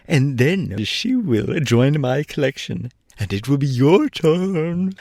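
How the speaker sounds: high and cheerful, slowly; a tiny tyrant delighted with himself; high, slow, cheerful gnome king. A narrator's reading. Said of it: "said the king cheerfully".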